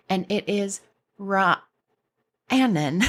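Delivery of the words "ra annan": Ra- Annan broken in two, pause after Ra; careful pronunciation. Shown as a demonstration. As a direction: sound out Ra, stop, then Annan. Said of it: "how to pronounce it".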